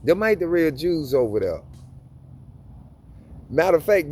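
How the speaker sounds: normal talking voice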